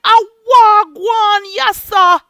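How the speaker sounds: Jamaican patois greeting. Retold or imitated. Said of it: "I'm like".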